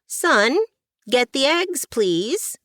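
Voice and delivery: high, carrying call from afar. Acted. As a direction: call out to the boy across the farm. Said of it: "Edna called from the barn".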